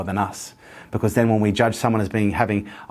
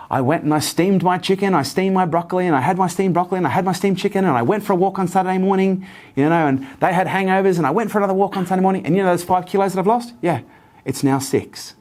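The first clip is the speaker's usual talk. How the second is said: much higher, breathless bragging; proud bubbly client; 9 semitones higher than usual. Retold or imitated. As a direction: rattle off her wins, beaming. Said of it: "she'd come in bouncing".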